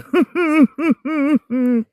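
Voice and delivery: high hooting laugh; owl-like laugh. Real, not acted. Laughing after telling viewers to run, hooting laugh. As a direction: let out a loud hooting laugh. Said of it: "My laugh sounds like an owl".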